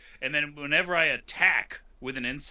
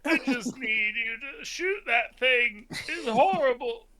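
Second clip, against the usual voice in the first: put-on pleading old man voice; 9 semitones higher, slower than usual. Old robot Picard begging for torpedoes.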